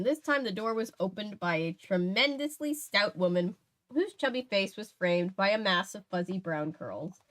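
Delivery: plain reading voice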